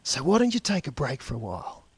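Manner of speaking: mock-gentle, ironic boss; sweet on the surface, cutting underneath; quiet, faintly sneering kindness; calm sarcasm. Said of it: "says calmly".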